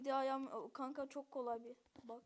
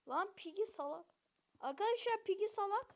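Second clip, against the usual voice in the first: normal talking voice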